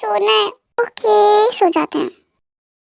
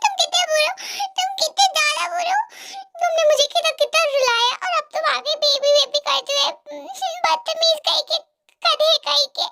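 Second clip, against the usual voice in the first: heroine voiced higher, hurt and sulky; teary heroine scolding; 6 semitones higher, 4 dB quieter than usual. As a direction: scold him like a girlfriend who has been crying. (रोई हुई लड़की की रूठी आवाज़)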